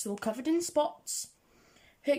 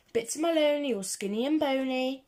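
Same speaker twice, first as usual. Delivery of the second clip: usual speaking voice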